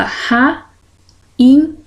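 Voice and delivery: "rainha" split into ra, i, nha; sílaba por sílaba. Shown as a demonstration. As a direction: sound out "rainha" in three separate syllables. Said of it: "É uma palavra trissílaba". ("rainha" separado em três sílabas, com pausas)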